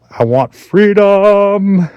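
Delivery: slow high wailing plea; dramatic plea. Pretend. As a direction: cry it out like a prisoner. Sudden dramatic aside while cleaning.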